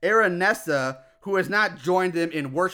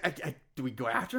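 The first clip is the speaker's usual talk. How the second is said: fast, softer stammer; hesitant, lower, hurried mumbling; mock perplexed; 7 semitones lower, 10 dB quieter, much faster than usual. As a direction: stammer it like an unsure mob member. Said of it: "seem perplexed".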